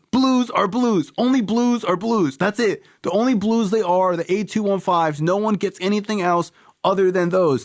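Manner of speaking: high-pitched, emphatic refusal; acted indignant rant. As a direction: lay down the law in a worked-up high voice. Acted quarrel over pill colour.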